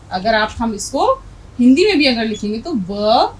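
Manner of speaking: ordinary talk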